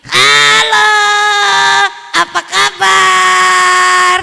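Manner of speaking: high, loud, slow puppet voice; slow puppet greeting. Shown as a demonstration. Ventriloquist showing the greeting without a puppet, high, loud and slow. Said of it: "nggak pakai boneka saya bisa gini".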